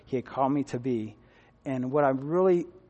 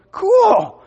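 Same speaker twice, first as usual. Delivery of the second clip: excited cool; 11 dB louder than usual. Retold or imitated. Reacts to news on campus.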